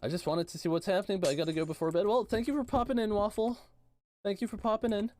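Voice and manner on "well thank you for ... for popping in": cheery thanks. Real, not acted. Thanking a viewer who is leaving.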